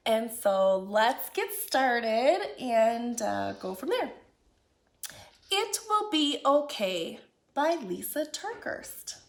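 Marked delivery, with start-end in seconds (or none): none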